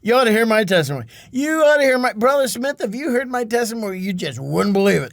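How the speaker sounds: high, loud, gushing self-promotion; mock bragging, pitched high and easing down; big-shotting. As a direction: play a boastful name-dropper, hammed up. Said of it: "he was big shotting".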